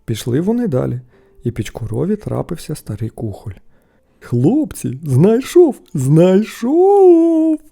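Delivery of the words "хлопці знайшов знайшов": rising to a high, loud, long cry; excited shout. A narrator's reading. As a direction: call the lads to see. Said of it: "вигукнув він".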